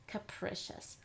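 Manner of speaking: "capricious" softly as "kuh-PRISH-us"; uh sound. Shown as a demonstration. Teacher trying an uh sound, word blended softly. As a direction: blend "capricious" with uh first, short I. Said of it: "let's try an uh sound here".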